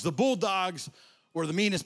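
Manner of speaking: usual speaking voice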